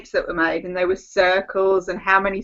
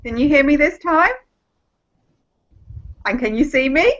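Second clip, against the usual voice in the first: usual speaking voice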